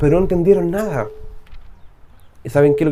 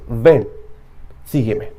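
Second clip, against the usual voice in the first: low divine call; 7 semitones lower, slower than usual. Acted. God calls Paul.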